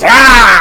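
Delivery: sudden loud high shriek; monster shriek. Acted. Milkman turning on the visitor, loud high shriek. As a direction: burst out screaming like the killer milkman. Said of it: "And then he goes".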